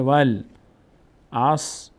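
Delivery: والعصر broken into slow, separate syllables. Shown as a demonstration. Teacher showing how the vav joins the lam, syllable by syllable. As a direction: sound out والعصر piece by piece, very slowly.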